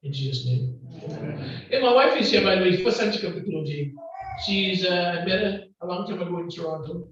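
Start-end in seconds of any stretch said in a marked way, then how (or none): 0.0-1.6 hushed opening prayer; quiet prayer. Real, not acted. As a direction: pray humbly to the Lord.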